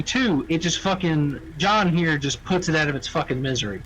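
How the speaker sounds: ordinary talk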